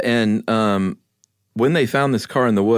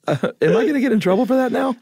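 laughing; faster than usual. Real, not acted. Laughs at his own accent.

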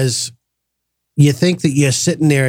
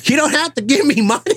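high, laughing protest, half amused; 10 semitones higher than usual. Retold or imitated. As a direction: laugh through the protest to the mentor. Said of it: "I'm like".

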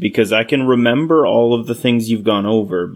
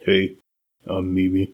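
character voice; 4 dB quieter than usual. Acted. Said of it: "how to say it in Crawlag's voice".

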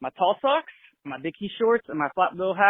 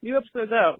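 mock hype; 9 semitones higher than usual. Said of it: "all we see is".

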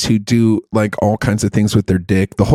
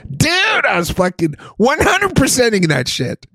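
very high, stretched-out crowing; excited boast. Real, not acted. Host boasting after his impression, high excited crowing. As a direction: crow about it in a high, elated voice.

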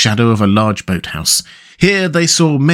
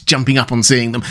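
plain reading voice

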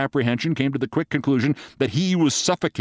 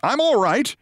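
high-pitched mock yell; acted call, raised in pitch; reassuring yell; 9 semitones higher than usual. A narrator's reading. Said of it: "Briggs yelled to reassure her".

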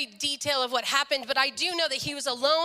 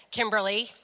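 imitated offhand, low-pitched name; uncaring greeting; 4 semitones lower than usual. Dad's mean friend greeting the daughter as usual, imitated uncaring. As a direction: say her name as if you don't care. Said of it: "but usually he says".

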